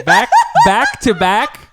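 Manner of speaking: drawn-out, high, loud mimicry; mocking imitation. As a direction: tease by overdoing the phrase, slow and loud. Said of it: "why do you have to say it like that too".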